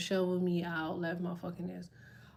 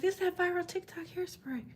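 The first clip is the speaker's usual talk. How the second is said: imitating the kids; 8 semitones higher, 5 dB quieter than usual. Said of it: "And the kids were like".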